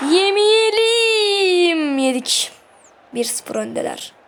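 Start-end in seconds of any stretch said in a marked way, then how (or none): 0.1-2.0 drawn-out, much higher pleading cry; slow, high anxious wail. Real, not acted. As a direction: beg the ball not to go in, stretched long. Opponent attacking, fearing a goal.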